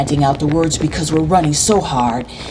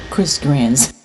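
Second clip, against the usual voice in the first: plain reading voice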